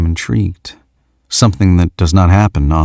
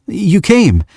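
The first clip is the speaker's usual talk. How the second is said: much higher, delighted greeting; cheering; 13 semitones higher than usual. Acted. Young man greets the woman he hoped would come, cheering. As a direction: cheer with relief she came. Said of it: "he cheers".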